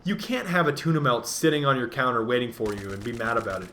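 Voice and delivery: ordinary talk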